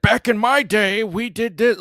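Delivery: high, loud, drawn-out old-timer impression; mock old-timer. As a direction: crow it like someone reminiscing about the old days. Mocks old people's nostalgia talk.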